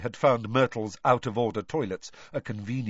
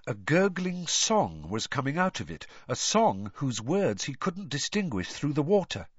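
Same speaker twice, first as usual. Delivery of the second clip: ordinary delivery, nothing marked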